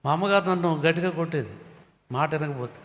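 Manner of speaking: ordinary talk, nothing marked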